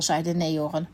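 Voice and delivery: usual speaking voice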